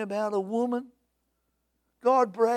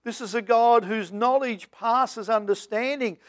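usual speaking voice

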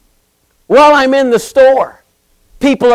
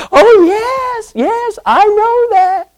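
much higher sing-song lady voice; high, sweet lady declining payment; sweet old lady; 12 semitones higher, much slower than usual. Retold or imitated. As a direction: squeak it like a polite little lady refusing money. Lady agrees about Jesus.

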